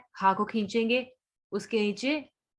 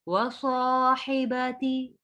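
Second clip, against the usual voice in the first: slow, melodic tajweed chanting; Quran recitation; 3 semitones higher, much slower than usual. Shown as a demonstration. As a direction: draw the vowels out in a slow recitation. Recitation demo.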